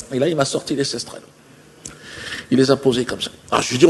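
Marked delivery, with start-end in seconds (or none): none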